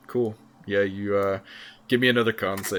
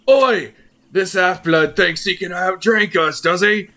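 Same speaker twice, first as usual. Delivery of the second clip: loud, high gruff dwarf hollering across the bar; brash drunken dwarf heckle; rowdy dwarf; 8 semitones higher, 5 dB louder than usual. Acted. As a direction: bellow it like a drunk dwarf picking a fight. DM voices rowdy dwarf calling out.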